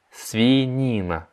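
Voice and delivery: свинина said slowly and clearly; slow teaching repeat. Shown as a demonstration. Teacher slowing свинина down for learners. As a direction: stretch свинина out. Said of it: "slow repeat of vocabulary".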